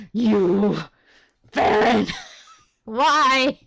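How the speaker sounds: loud, breaking into laughter; exasperated outcry. Real, not acted. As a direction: cry out in exasperated outrage. Reacting to hated character's arrival.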